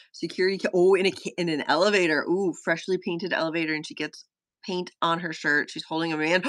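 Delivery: ordinary talk, nothing marked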